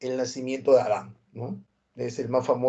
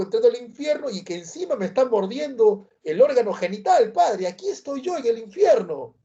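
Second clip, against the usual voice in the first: much higher, whiny lament, slowing on the insults; whining merchant; 8 semitones higher than usual. Retold or imitated. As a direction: plead to the pope about his own humiliation. Comerciante se lamenta ante el papa. (comerciante quejumbroso, voz muy aguda)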